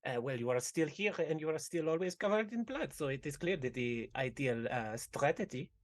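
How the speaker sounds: bubbly, sweetly formal NPC voice; a chirpy villager fussing over a friend; sing-song NPC voice. Acted. Innkeeper chatting to Mishka, in a bubbly sing-song voice.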